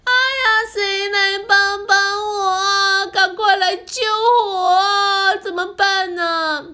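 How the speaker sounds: high tearful cries for help; crying and calling out. Acted. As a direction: wail for someone to save her. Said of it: "小红边哭边喊".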